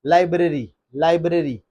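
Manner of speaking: लाइब्रेरी as LAI-bruh-ree, falling; correct pronunciation. Shown as a demonstration. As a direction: repeat लाइब्रेरी with a short middle vowel. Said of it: "Actually word है". (लाइब्रेरी सही, लाइ-ब्र-री)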